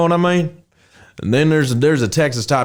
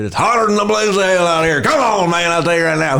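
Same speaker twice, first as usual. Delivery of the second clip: a hint of an upbeat Texan guy; country character voice; 6 semitones higher than usual. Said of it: "I created this character".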